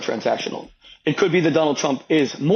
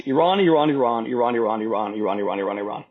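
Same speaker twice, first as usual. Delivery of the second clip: droning repetition; 6 semitones lower than usual. Real, not acted. Naming the top foreign policy issue, a droning, fading repetition. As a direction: run the repeats together, steadily sinking and fading. Repeating one word to hammer the point.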